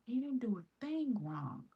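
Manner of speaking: imitating a kind lady's high whisper; whispered reassurance. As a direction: hush and comfort the mother. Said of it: "She was like".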